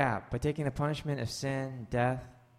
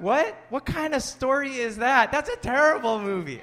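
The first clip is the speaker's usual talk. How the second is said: baffled audience; 10 semitones higher, 6 dB louder than usual. Retold or imitated. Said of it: "Everyone's going".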